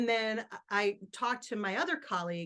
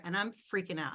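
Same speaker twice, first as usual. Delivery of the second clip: ordinary delivery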